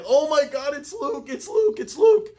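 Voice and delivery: very high, loud, building squeal; excited shriek. Retold or imitated. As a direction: scream it out like a thrilled fan. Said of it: "And I'm like".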